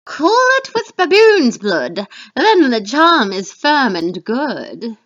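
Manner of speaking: seal the charm gleefully; witch voice. Acted. Teacher voicing a witch finishing the charm, loud and slow. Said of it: "This is the third witch".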